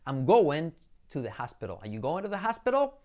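"hospital" as HAS-pero; pronunciación correcta. Shown as a demonstration. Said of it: "como si fuera áspero". ("hospital" como «jáspero»)

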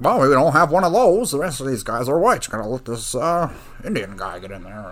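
hammed-up grumbling, starts high and loud; mock complaining officials. Mimics admissions people's grudging talk.

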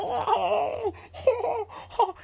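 very high mocking ho ho; high mock laugh. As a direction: laugh like a snooty nerd. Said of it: "they're like".

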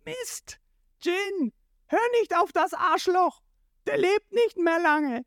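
very high, strained hero shout; urgent yell. A narrator's reading. Ruffy calling to Jin, urgent high yell. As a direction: holler a warning across the fight.

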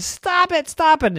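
high, pleading stop; whiny kid. Acted. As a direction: beg her to quit. Brother fed up.

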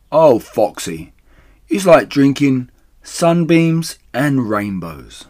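slow, loud, high swooning delight; rapturous gasp. A narrator's reading. Badger to Foxy over the cider, swooning gasp. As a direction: draw it out, drunk with bliss. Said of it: "he gasped".

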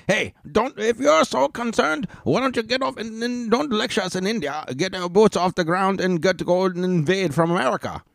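hammed-up imitation of the shouting panelist; mocking the panelist. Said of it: "So he's like".

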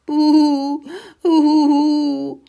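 high, loud, drawn-out boo-hoo; very loud crying. A narrator's reading. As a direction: bawl it out, story-book style. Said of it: "weinte Muckelinchen inzwischen sehr laut". (lautes, gespieltes Heulen)

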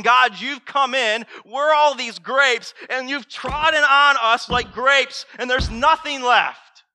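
high, loud, slow desperate plea; anguished cry to God. Acted. Jeremiah crying out to God, loud and desperate. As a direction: cry out like a broken man.

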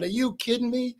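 incredulous retort. Retold or imitated. Retold disbelief at nomination.

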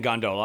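gondola with a long stressed middle, gon-DOH-la; local gon-DOH-la. Shown as a demonstration. Asking Tyler about his local word, gon-DOH-la. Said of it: "you grew up saying".

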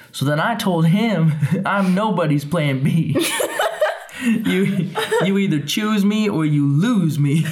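put-on high, laughing girlish gossip; giggly gossip. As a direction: giggle through it like a gossiping diva. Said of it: "you hear her say".

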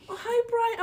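very high, put-on sweetness; mock-friendly greeting. Friend meeting the imagined Brian, high mock-friendly greeting. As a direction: greet the date in a high-pitched coo.

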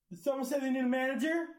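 high, loud teasing shout; mocking call-out. Coworker teasing him about Karen hair, mocking call-out. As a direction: pipe up loudly in a high, teasing voice.

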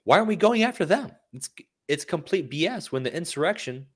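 high, outraged rhetorical question; retelling Del Rio's outrage, pitched high; indignant quote. Retold or imitated. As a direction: demand it like an angry coach. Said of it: "Jack Del Rio had some strong words".

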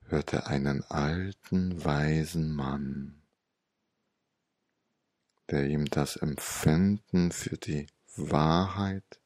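plain reading voice

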